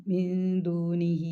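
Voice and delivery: മിൻ ദൂനിഹി chanted slowly with the long madd on ഹി stretched; slow, stretched tajweed chant; slow Quran recitation. Shown as a demonstration. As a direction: hold the madd out as you recite. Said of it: "ഇത് വലിയ മദ് എന്ന് പറയും".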